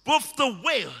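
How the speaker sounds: emphatic, building sermon refrain; ringing pulpit declaration; full-force preaching. Real, not acted. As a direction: preach it out, hammering each parallel phrase.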